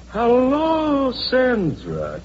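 high-pitched drawn-out hello, settling as it goes; effusive greeting. Acted. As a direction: gush a welcome to a prized guest. Producer greets the star he wants.

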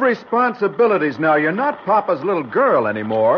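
cross, high-pitched telling-off; scolding. Acted. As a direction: lecture your wife in exasperation. Husband scolds wife in quarrel.

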